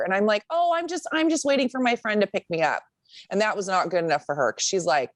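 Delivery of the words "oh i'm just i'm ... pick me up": high, meek excuse; lightly high innocent excuse; sheepish explaining. Retold or imitated. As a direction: talk your way out innocently. Explaining to neighbour.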